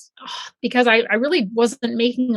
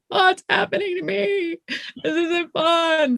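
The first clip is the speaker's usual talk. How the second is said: higher, bewildered inner voice; mock dismay; 7 semitones higher, slower than usual. Recalling the crazy ride of quitting, higher mock dismay. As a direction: act out being lost mid-change, a touch alarmed. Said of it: "this crazy ride that you're like".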